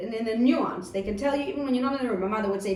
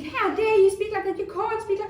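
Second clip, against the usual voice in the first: high-pitched, louder outraged parent; hammed-up shocked telling-off; indignant scolding; 11 semitones higher, 6 dB louder than usual. Parent reacting to child's violent talk, indignant scolding. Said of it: "and I'm thinking".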